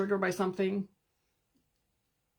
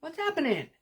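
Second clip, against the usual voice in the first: put-on high, puzzled child's voice; confused little ones; 9 semitones higher than usual. Little inner children asking what's happening, high bewildered child voice. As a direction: ask it like a bewildered small child. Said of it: "the other little ones are like".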